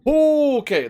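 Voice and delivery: high, loud okay of relief; exasperated okay. Real, not acted. Streamer done with a hard twister, loud high okay. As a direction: let it burst out high.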